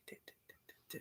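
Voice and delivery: soft whispered dit dits; mimicking clicking the window; whispered clicks. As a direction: tick it off under the breath. Imitating clicking sound.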